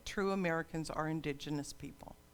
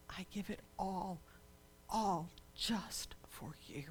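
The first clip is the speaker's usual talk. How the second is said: soft plea; 11 dB quieter than usual. Acted. God tenderly offering everything to his people, hushed. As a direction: offer it gently, almost in a whisper. God's plea softening to intimacy.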